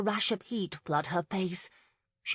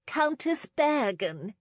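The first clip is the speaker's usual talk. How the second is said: high, slow hostess call of "Countess Bergen!"; 8 semitones higher, 3 dB louder, slower than usual. A narrator's reading. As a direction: sing out the name across the ballroom. Hostess calling out across room.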